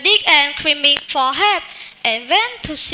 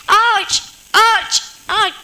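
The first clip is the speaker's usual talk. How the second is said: hunter yelping; 5 semitones higher, slower than usual. Acted. Ant bites hunter's foot.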